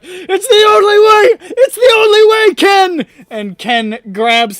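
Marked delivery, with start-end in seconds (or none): 0.0-3.1 much higher, loud desperate cry; desperate cultist. Acted. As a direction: plead as they leap for the window. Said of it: "they're like".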